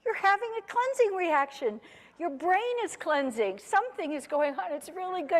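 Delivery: high, cheery husband voice; chirpy reassuring husband, hammed-up. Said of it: "And he said".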